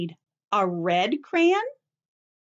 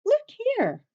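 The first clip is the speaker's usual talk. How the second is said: much higher, bright call for attention; excited pointing out; 14 semitones higher than usual. Real, not acted. Teacher to her class, excited high call to look. Teacher showing kids a picture.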